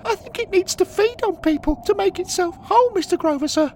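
put-on very high, loud panicked boy; trembling, upset boy. Boy tells Henry the ghost attacked him, trembling and upset. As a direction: squeal it out as a frightened lad. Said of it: "The boy, trembling and upset".

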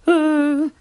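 high-pitched, drawn-out hesitation; hesitant gazelle. Acted. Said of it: "répond une gazelle".

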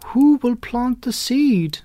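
high, loud, slow hen's call; little red hen calling loudly. A narrator's reading. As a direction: call out across the barnyard. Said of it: "she called loudly".

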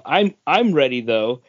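ordinary talk

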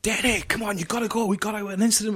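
high, urgent panicked call; mate bursting in, panicking about an incident. Retold or imitated. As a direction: rush him out the door in a panic. Ronnie panics, bursts into office.

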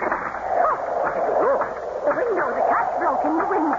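high, loud startled gasp of words; startled cry. Acted. Maid startled by the storm-broken window, crying out high.

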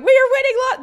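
high, giddy laughing shout; excited cheer. Retold or imitated. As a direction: crow it like a winner. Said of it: "we were like".

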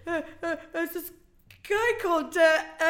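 imitating a caller frozen with nerves on live radio; nervous stammer. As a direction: freeze up mid-sentence like stage fright. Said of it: "then he just froze".

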